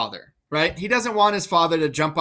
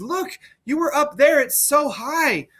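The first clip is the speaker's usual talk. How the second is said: higher, awed stranger pointing upward; guy at cliff bottom; 8 semitones higher than usual. Acted. Edgar as a stranger urging Gloucester to look up, awed. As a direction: marvel at the height as a passerby. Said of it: "pretends to be a guy at the bottom of the cliff".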